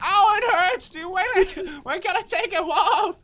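ordinary talk

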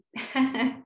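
light, amused little chuckle; short laugh. Real, not acted. Yoga teacher after the hang ten sign, brief laugh.

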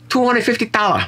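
mock seller's price in a high, exaggerated Chinese accent. Joking about a Japanese shop's price, wrongly in a high Chinese accent. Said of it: "That was a Chinese accent".

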